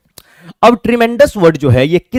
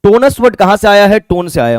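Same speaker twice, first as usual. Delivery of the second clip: ordinary delivery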